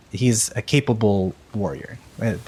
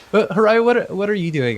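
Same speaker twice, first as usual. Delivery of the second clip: calling out; 9 semitones higher, 4 dB louder than usual. Real, not acted. Calling out to character.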